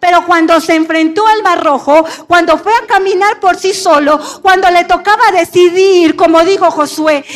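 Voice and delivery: preaching at full force. Acted. Pastor to the church about Moses, preaching hard. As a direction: build the Moses story like a rising sermon.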